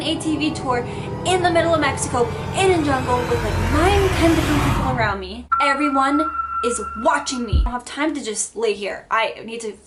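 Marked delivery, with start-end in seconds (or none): 1.2-5.5 panicked rush. Real, not acted. Panicked realization after crash.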